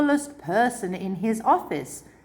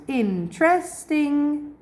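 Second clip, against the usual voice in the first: Interesting drawn out slowly in separate syllables, IN-TER-EST-ING, pitched higher; syllables spelled out slowly; 8 semitones higher, much slower than usual. Shown as a demonstration. Teacher letting students hear the vowel sounds, slow and separated. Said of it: "So I hear three vowel sounds".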